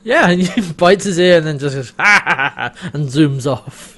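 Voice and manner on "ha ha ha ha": loud put-on "ha ha ha"; mock triumphant cackling; imitated gleeful cackle. Host imitates Rigel fleeing after the bite, mock cackle.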